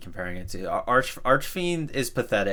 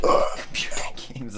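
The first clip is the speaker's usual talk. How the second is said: laughing; 3 semitones higher than usual. Real, not acted. Laughing repeat.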